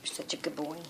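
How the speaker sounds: soft praise to a pet; talking to a dog. Real, not acted. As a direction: coo it softly to the dog.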